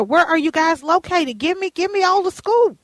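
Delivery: ordinary delivery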